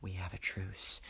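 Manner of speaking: a hint of Joker menace; quoting Joker quietly to the audience; bad Joker impression. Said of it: "that was probably a bad impression".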